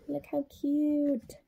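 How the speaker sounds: soft, slow coo over something cute. A narrator's reading. Narrator cooing to children at the cute monster. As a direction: coo it softly like admiring a baby.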